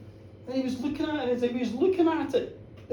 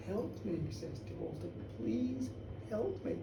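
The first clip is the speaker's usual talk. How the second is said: weak plea; 15 dB quieter than usual. Acted. Dying man begs his friend.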